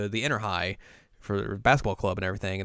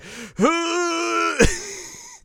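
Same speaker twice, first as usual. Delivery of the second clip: huge high-pitched fangirl gasp; long, loud, drawn-in gasp; dramatic gasp; 6 dB louder than usual. Acted. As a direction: gasp in exaggerated shock. Acting Setsuna's shocked reaction.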